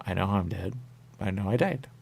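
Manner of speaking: ordinary talk, nothing marked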